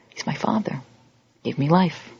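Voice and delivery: hushed, awed whisper; solemn whisper. Acted. Preacher turning to God as Father, reverent whisper.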